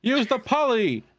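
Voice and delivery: high, loud shouted taunt; yelling at the screen. Acted. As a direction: egg him on like a heckler. Yelling advice at cartoon hero.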